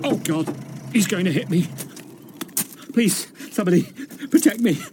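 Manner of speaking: halting, panicky plea for protection; frightened pleading. Acted. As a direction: beg for help, rising in fear. Fears being hit, begs for help.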